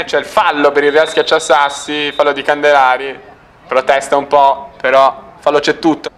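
ordinary delivery

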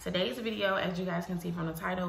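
ordinary talk

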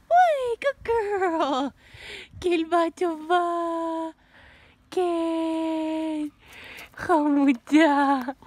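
high, loud, slow praise; lavish praise on the dog; high, slow gushing praise. Real, not acted.

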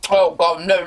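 usual speaking voice

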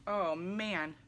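usual speaking voice

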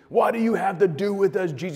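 high, loud strained croak of a possessed man; croaking demon voice. Retold or imitated. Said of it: "He's croaking. He's got this weird voice".

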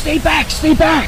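loud, slow warning call; urgent shout. Real, not acted. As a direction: hold everyone back, loud. Urgent warning during prank.

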